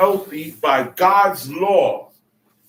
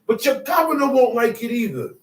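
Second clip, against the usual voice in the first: higher, insistent declaration; indignant God; 7 semitones higher, 3 dB louder than usual. Pretend. Voicing God speaking.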